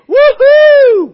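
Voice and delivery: very high, very loud cheering woohoo; woohoo yell. Shown as a demonstration. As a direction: shriek it like a celebration. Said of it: "we're gonna do the woohoo one more time".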